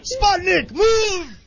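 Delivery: hammed-up Scottish father shouting; mock Scottish yell. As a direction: bellow at the kid in Scottish. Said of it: "So I Married an Axe Murderer".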